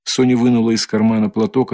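ordinary delivery

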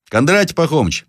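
put-on high boyish call; крик мальчика. A narrator's reading. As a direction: call down to the old man. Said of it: "закричал мальчик". (звонкий мальчишеский окрик)

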